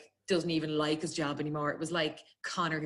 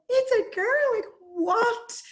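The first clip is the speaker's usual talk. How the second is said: much higher and louder, laughing in disbelief; shocked disbelief; 9 dB louder, much slower than usual. Retold or imitated. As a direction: squeal it out like you can't believe it. Her shocked reply, retold.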